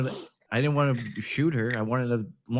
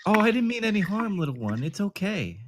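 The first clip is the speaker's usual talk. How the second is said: mock soothing; 7 semitones higher than usual. Soothing the frightened girl.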